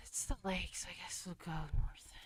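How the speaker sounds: hushed whisper while exploring. Real, not acted. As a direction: breathe the words in a whisper. Quiet aside while exploring map.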